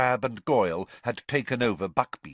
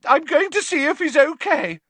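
very high, loud, upset cry; Pansy in tears; 9 dB louder than usual. A narrator's reading. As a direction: announce it in a tearful wail. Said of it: "who was in tears".

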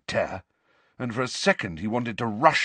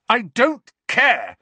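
slow, much higher and louder yell; 13 semitones higher, 7 dB louder, slower than usual. A narrator's reading. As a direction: shout it at the portraits. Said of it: "Harry yelled at them".

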